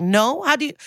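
high, faux-Spanish fumbling for words; Hilaria Baldwin. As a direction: grope for the English word in a Spanish accent. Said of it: "he's very Hilaria Baldwin here".

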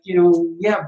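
ordinary talk, nothing marked

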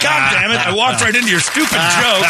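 high-pitched comic exasperation; mock-annoyed outburst at being had; exasperated. Acted reaction to being fooled.

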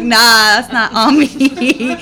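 slow, laughing brush-off; laughing denial. Real, not acted. To her husband about the swearing, slow laughing denial. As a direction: laugh it off slowly, deflecting blame.

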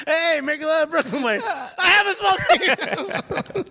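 very high, hammed-up comic voice; mock excited fan. Host clowning as the joked-about man, high silly voice. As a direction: squeal it as a silly, high-pitched character.

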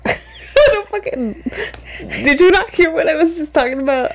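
high, laughing and incredulous; laugh, can't believe it; laughing disbelief. Real, not acted.

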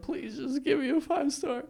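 high, soft mock pleading; small high voice begging nervously; stressed-out plea. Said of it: "stressed out in the back".

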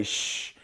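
drawn-out hushing sound; soft "shh" for keeping a secret; shushing. Shown as a demonstration. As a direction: shush with a finger on the lips. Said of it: "put my finger on my mouth and say".

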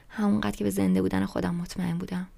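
usual speaking voice

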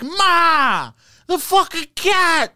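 high, drawn-out mock Boston shout; imitated Boston yelling. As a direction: holler for Ma like a TikTok Bostonian. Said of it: "And he's yelling".

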